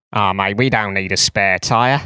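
slow, laid-back mock Australian drawl; a lightly done Aussie coach brushing it off; Eddie Jones Aussie impression. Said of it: "so many impressions ... of Eddie Jones".

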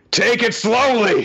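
high, slow, loud shout. Retold or imitated. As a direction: shout it slowly and firmly. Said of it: "he, like, shouts at Hachimaru".

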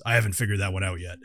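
low, quick deadpan reply; Shayla. Acted. Muscle answers the gnome, low and quick. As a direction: drop the pitch and answer fast. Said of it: "And Shayla says".

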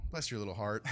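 lightly teasing, after the big outburst; gently patronizing Jesus. Acted. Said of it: "I believe Jesus is like".

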